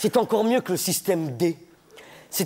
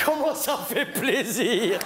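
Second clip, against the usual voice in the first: drawn-out happy shout; slower than usual. Acted. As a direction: stretch it out gleefully. Slow loud comic exclamation.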